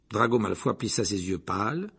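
ordinary delivery, nothing marked

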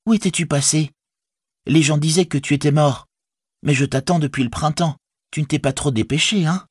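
put-on high-pitched young hobbit woman; reproachful Rosie. A narrator's reading. Rosie chides Sam for coming late, mildly reproachful. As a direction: tease him with a high, mild reproach.

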